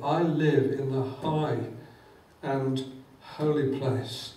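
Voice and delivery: slow, measured, solemn words; slow voicing of God. Preacher voicing God's answer, slow and solemn. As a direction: give God's reply unhurried and grand.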